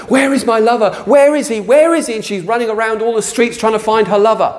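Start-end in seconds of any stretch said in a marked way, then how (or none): 0.1-2.1 much higher, loud anxious calling; searching bride cry. Acted. The bride in Song of Solomon searching, high anxious calls. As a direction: call out desperately for a lost lover. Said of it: "Like Song of Solomon".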